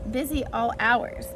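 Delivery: plain reading voice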